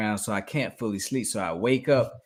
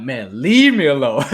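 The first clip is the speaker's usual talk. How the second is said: louder, slowed begging; mock plea; 2 semitones higher, 10 dB louder than usual. Boy telling the figures to leave, loud slow plea. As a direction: drag it out, pleading to be left alone.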